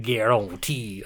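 guarantee drawn out in a Southern accent. Shown as a demonstration. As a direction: put on a slow, cartoonish Southern accent. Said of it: "I prefer your southern accent".

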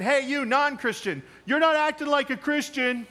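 mocking accusation. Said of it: "That's like looking at somebody and saying".

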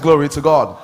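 loud preaching call. Real, not acted. Pastor calls out to the congregation for a response, loud. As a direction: raise it to the congregation again. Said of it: "I said".

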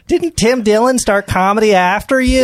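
high mock-wife needling; nagging question. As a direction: needle him in a hammed-up high voice. Acted nagging wife's last words.

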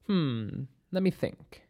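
hesitant, hushed musing; pondering. Acted. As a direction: mull it over quietly. Doctor pondering an agent's question.

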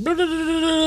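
high mock clarinet honk; mock clarinet toot. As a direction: sing the blahs like a squeaky clarinet.

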